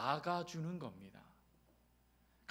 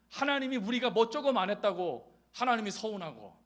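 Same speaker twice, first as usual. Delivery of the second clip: ordinary delivery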